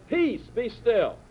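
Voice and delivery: high, very slow, drawn-out command; Jesus commanding the storm in a high, very slow voice; calm command. Retold or imitated. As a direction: still the storm with a slow, firm word. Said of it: "stands up in the boat and says".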